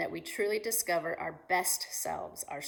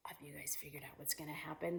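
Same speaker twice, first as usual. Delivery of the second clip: secretive whisper; 3 semitones lower, 10 dB quieter, faster than usual. Acted. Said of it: "whispered question to kids".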